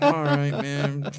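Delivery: slow, drawn-out, high mock resignation; mock resigned. Imitating Edge giving in, slow mock resignation. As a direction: stretch it out like giving up.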